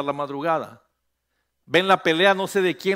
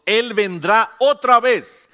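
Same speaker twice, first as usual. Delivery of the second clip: loud, higher and slow, each word hammered; shouted promise; 7 semitones higher, 10 dB louder, slower than usual. Shown as a demonstration. Pastor calling the congregation to repeat after him, loud. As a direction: call it out for the church to repeat. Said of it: "diga conmigo".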